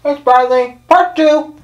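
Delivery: talking with cheeks stuffed full; mouth full. Real, not acted. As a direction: say it through a mouthful of food. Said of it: "With his mouth full".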